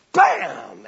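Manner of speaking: loud, high "bam" impact; battle axe blow. Retold or imitated. Preacher showing the line strike home, explosive shout.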